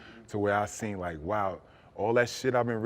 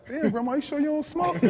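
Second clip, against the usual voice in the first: much higher, playful disbelief; high mock-amazed question; amazed teasing; 6 dB louder than usual. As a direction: tease her like you've caught her out. Teasing grandma smoking like pro.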